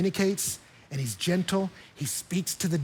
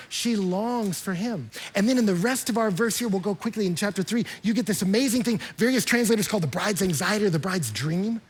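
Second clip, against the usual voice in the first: ordinary talk, nothing marked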